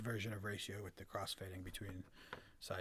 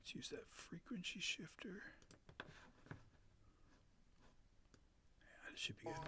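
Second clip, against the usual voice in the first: soft breathy whisper; 6 semitones higher, 15 dB quieter than usual. Real, not acted. Whispering while setting up effects.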